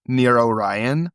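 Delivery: slow, drawn-out NEAR ORION; slow mysterious caller. Acted. Caller's line, then [name] repeats it.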